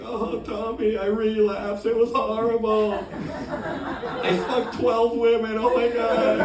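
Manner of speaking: high-pitched, whiny put-on voice; complaining diva addict. Diva sex addict complaining to a fellow addict, high whine. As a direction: yap it out in a high, drawn-out wail. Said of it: "Sex addicts complaining, yapping".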